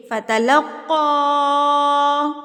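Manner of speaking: فتلقا with قا held for six counts; long madd. Shown as a demonstration. Teacher shows six-finger counting on فتلقا, stretched. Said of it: "پھر اس کو کھینچیے".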